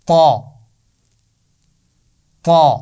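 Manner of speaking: loud, thick طا; heavy ta sound. Shown as a demonstration. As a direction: say طا full and heavy, puffed like a balloon. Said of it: "سب پڑھیے". (طا موٹی آواز سے)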